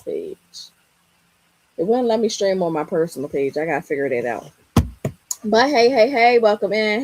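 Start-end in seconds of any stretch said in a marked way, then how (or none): 5.4-7.0 louder, repeated upbeat "hey"; host greeting newcomers with excitement; excited greeting. Real, not acted. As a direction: pile on the hellos with energy. Greeting arriving viewers.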